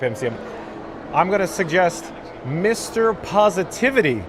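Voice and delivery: ordinary delivery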